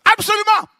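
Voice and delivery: high, loud, ecstatic yes; eager yes. Retold or imitated. Preacher answering the Lord, ecstatic shouting. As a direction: shout your yes up to heaven.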